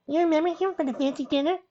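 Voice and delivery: put-on very high little-girl voice; little filly. A narrator's reading. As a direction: speak high and small, introducing a friend.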